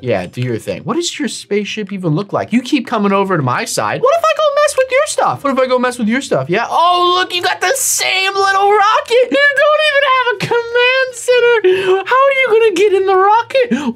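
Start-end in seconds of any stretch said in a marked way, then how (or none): 4.0-5.4 high, fast, mocking squeak; squeaky teasing voice. Taunting rival, repeated line. 6.7-13.9 mocking high voice. Mocking rival's small rocket.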